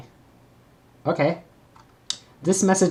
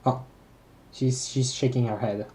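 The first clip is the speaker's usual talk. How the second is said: ordinary talk, nothing marked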